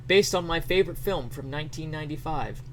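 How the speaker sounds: normal talking voice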